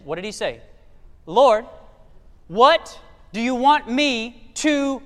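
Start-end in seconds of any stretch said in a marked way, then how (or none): 1.2-5.0 slow trembling plea. Acted. Voicing Saul's plea, drawn out.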